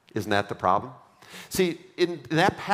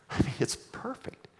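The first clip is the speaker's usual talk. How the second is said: awed whisper; 5 semitones higher, 5 dB quieter than usual. Acted. Awe at Eden's perfection.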